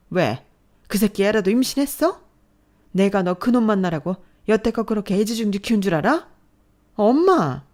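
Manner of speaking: mother yelling at her daughter; furious yelling. Acted. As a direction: hurl the accusation.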